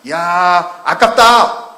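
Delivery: loud, high exclamation of waste; Judas's blunt complaint. Retold or imitated. Judas blurting to the disciples that it's a waste, loud and high. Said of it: "이렇게 얘기를 한 거예요".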